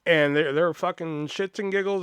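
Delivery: usual speaking voice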